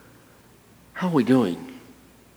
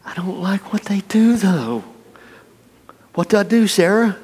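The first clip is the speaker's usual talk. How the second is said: mock helpless plea; 8 semitones higher than usual. Torn churchgoer asking.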